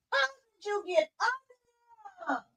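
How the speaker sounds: high, tearful plea; crying. Real, not acted. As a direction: near tears, hushed. Puppy trapped, crying voice.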